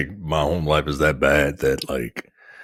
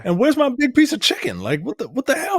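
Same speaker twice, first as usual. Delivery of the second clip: much higher, yelling indignant husband; Chris Rock bit. Retold or imitated. Husband just home from work, outraged: high mock yell. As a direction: squeal it like a fed-up husband in a stand-up bit. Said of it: "that Chris Rock bit".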